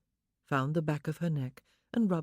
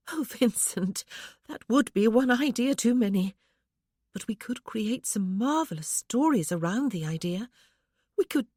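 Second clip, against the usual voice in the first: lightly voiced young wife, higher, wistful; a wife lifted a little higher, yearning; young woman's voice; 6 semitones higher, 5 dB louder than usual. A narrator's reading. Wife tells husband her longing, lightly higher voice.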